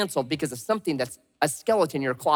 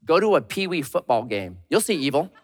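ordinary talk, nothing marked

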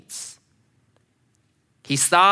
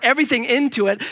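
ordinary talk, nothing marked

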